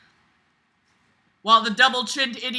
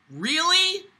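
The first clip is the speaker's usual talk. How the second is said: sarcastic surprise; 8 semitones higher than usual. Real, not acted. Sarcastic reaction to narration.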